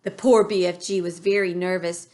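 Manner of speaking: plain reading voice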